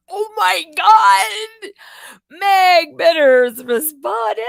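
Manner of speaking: high, slow, loud squealing fangirl shriek; teenage girl squeal. Retold or imitated. Squealing to viewers like a teenage girl over a reply. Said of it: "Like a little teenage girl".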